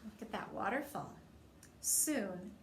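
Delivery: ordinary delivery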